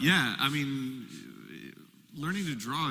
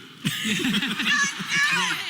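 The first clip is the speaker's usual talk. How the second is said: high laugh; 5 dB louder than usual. Real, not acted. Laughs at own joke.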